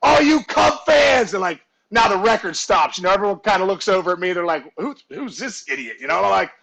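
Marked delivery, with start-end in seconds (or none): 0.0-1.3 higher, drawn-out call to a crowd; mock yell across a packed bar; hollering for the room's attention, slightly held back; retold yell. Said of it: "I yell at the top of my lungs". 4.8-6.0 low, much softer aside; hushed, lower mock grumble; muttered disbelief. Bar crowd puzzled at him.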